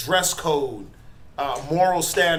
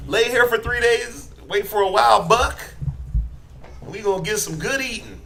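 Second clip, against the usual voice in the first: a hammed-up Southern hunting dad, higher pitched; mock Southern accent, pitched up and playful; 5 semitones higher than usual. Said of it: "That's my whack Southern accent".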